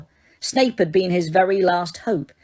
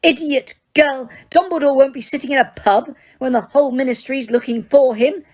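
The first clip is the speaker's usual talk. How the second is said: angry shout; 6 semitones higher than usual. A narrator's reading. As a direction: scold her hard. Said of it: "shouted Umbridge".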